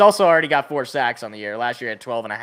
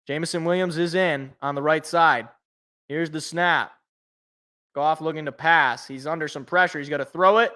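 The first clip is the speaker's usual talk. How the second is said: play-by-play. Acted. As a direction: narrate the play live like a TV announcer. Play-by-play of the drive.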